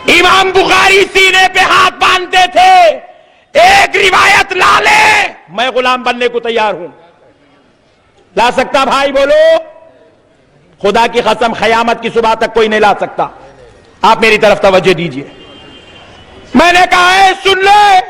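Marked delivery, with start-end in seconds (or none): 0.1-5.3 high, ringing preacher's dare; shouted challenge. Real, not acted. 16.5-18.0 high, drawn-out shouted demand; full-force preaching challenge. Acted.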